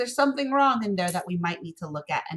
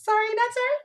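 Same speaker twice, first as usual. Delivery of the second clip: teasing mock apology; 4 dB louder, faster than usual. Teasing students over tricky pattern.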